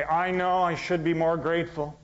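a lightly voiced mock of self-reproach; mock guilty sigh. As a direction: mimic someone nagging themselves. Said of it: "when you say".

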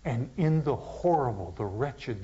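ordinary talk

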